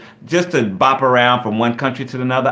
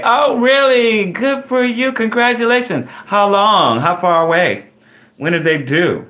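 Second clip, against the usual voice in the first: real pitched-up, celebratory surprise; delighted congratulations; 10 semitones higher than usual. Real, not acted. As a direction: squeal with delight for the new grandparent. Reacting to news of a grandson.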